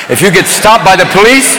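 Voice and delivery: ordinary talk, nothing marked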